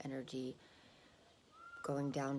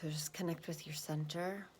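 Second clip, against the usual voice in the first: calm grounding exercise. Acted. Meditation instruction.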